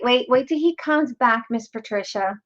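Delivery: ordinary talk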